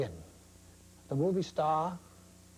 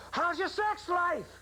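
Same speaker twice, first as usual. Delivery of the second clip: higher, slow mock yell of a frustrated reporter; retold yelled question; 13 semitones higher, slower than usual. Retelling a reporter's shout at the president, a slow mock yell pitched high. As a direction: lob the question like a mild mock shout over the noise. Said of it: "he yells".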